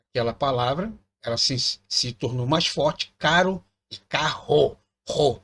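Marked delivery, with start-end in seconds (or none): 3.2-4.8 caro with a tapped r, carro with a strong rolled r, slowly; caro versus carro. Shown as a demonstration. Teacher showing class how carro's r sounds stronger, slow. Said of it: "Tá vendo como é que o som ... sai mais forte".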